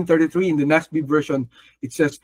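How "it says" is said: ordinary delivery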